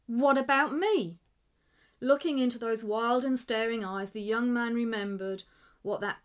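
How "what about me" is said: desperate. A narrator's reading. Desperate Slugger.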